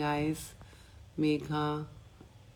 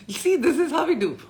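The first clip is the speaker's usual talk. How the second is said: bursting with laughter, high and loud; a big laugh over the words; 11 semitones higher, 9 dB louder than usual. Real, not acted. Laughing at a listener's question.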